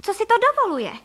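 very high, loud outburst; indignant cry. A narrator's reading. Wife outraged at the duck in her kitchen, indignant cry. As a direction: shriek it in outrage, falling at the end. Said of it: "vykřikla paní Frejková".

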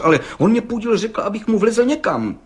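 high-pitched, flustered excuse; stammering self-defence. Acted. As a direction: protest in a high, helpless voice. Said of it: "koktá mladý cihlář".

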